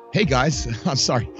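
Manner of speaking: ordinary delivery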